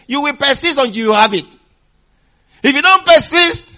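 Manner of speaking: loud, high, driving sermon build; fervent preacher pushing the crowd; preaching at full force. Real, not acted. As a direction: press the congregation to persist.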